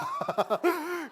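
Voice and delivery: big high burst of laughter; loud high laugh. Real, not acted. Preacher laughs because the son really did it, loud laugh.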